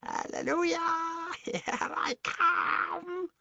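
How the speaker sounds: very high, slow triumphant crone's cry; ecstatic old hag. Acted. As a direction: crow it like a gleeful witch. Grandma growing through floor.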